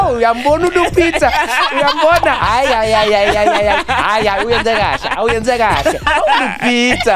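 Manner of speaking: proud grandparents cheering. Retold or imitated. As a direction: gush like proud African grandparents over Lupita. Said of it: "We're like".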